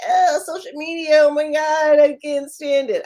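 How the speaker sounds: dramatic fed-up complaint; mock groan. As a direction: play the client who hates social media. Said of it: "if you're like".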